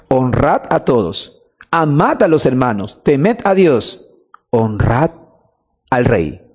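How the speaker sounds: deliberate re-reading, "honrad" set apart from "al rey"; emphatic repetition. Shown as a demonstration. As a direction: weigh each phrase, ending low on "al rey". Repeats the verse slowly for emphasis.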